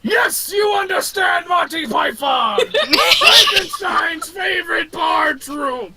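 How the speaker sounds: high, excited monster shouting; overjoyed Frankenstein's monster, very high pitched; delighted monster. Acted. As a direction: bellow with a giant's glee. Monster delighted at Monty Python answer.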